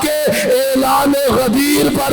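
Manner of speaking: full-throated majlis chant with Quranic lines; impassioned preaching. Acted. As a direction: build the sermon to a sung climax. Preaching peak with chanted verses.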